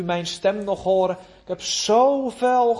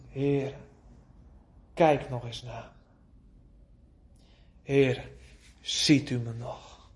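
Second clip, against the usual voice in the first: soft pleading prayer; 6 semitones lower, 4 dB quieter than usual. Retold or imitated. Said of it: "Kent u dat gebed?".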